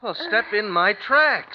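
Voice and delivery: loud, high and slow over the wind; husband shouting directions to his wife through the storm; shouted instruction. Acted. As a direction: call each word across the snow. Calling directions in the snowstorm.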